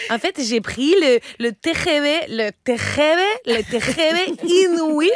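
put-on French with a thick Spanish accent. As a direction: play a Spanish woman, Lola. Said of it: "je me mets à parler comme ça".

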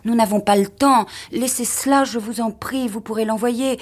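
urgent pleading. A narrator's reading. Wife urging her husband to leave the gift, urgent pleading. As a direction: plead against the clock. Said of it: "s'écria-t-elle".

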